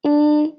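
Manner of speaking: I as "ee", high and level; first tone. Shown as a demonstration. Teacher drilling fourth line, i high flat. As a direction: hold I ("ee") on a high pitch. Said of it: "And the fourth line".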